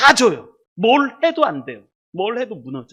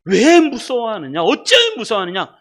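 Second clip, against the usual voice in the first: preacher voicing a stern rebuke; acted rebuke; 2 semitones higher, 6 dB louder than usual. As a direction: challenge them loudly, leaning on the question. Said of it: "예수님이 탁 책망하실 때".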